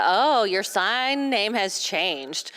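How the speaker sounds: ordinary delivery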